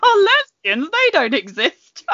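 mock scandalised queen, half laughing; Queen Victoria. Co-host mimicking the queen's dismissal of lesbians, scandalised. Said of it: "Queen Victoria said that. She was just like".

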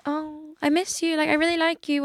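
mock affectionate. Breadcrumber texting someone to keep them interested, mock affectionate.

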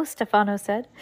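ordinary delivery, nothing marked

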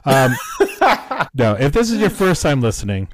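laughing through the words; chuckling as you say it. Real, not acted. Laughs while restarting the welcome.

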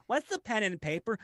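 mock toddler asking; clueless kid voice. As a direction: play the confused child. Kid viewer voiced in story.

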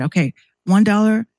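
ordinary talk

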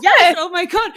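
loud, high, laughing exclamation; elated. Retold or imitated. Listener won over by the therapist's idea, retold elation. Acts out delighted reaction to podcast.

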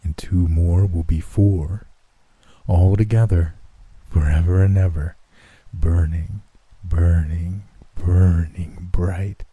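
dying man's uncanny slow murmur; voice lowering strangely. A narrator's reading. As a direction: drag out each burning like a curse. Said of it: "his voice lowering strangely".

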